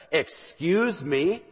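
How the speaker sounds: slow, affronted "Excuse me," stretched on a rise; the great man taking offence, drawing out "Excuse me"; indignant. Retold or imitated. Proud Naaman insulted by the prophet's messenger, indignant.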